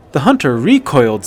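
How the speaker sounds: ordinary delivery, nothing marked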